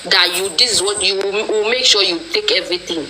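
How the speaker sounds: usual speaking voice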